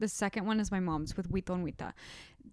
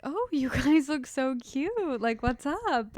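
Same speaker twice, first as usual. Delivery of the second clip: surprised delight; 6 semitones higher, slower than usual. Retold or imitated. Surprised at mom and sister.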